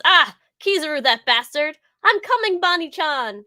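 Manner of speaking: loud, high charging cry; heroic shout. Retold or imitated. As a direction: call out in a rush to the rescue. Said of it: "He goes".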